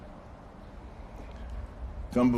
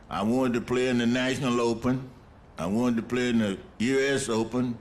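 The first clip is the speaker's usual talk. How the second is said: normal talking voice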